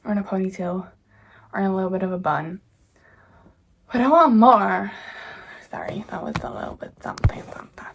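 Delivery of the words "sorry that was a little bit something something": quick whispered apology under the breath; hushed sheepish aside; embarrassed whisper. Real, not acted. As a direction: whisper it fast, a bit embarrassed. Embarrassed aside after a slip.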